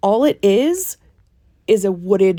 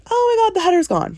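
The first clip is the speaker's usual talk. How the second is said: excited; 11 semitones higher than usual. Retold or imitated. Fans freaking out, quoted.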